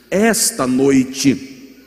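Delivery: full-force preaching. Acted. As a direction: preach it out loud to the whole church. Sermon climax, full force.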